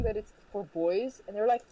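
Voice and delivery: ordinary talk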